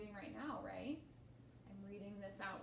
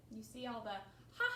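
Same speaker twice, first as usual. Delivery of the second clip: plain reading voice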